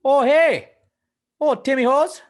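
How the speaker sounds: high, loud mock-Canadian enthusiasm; hammed-up Canadian caricature; Canadian accent. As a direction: whoop it like a delighted Canadian. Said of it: "Canadian is hard".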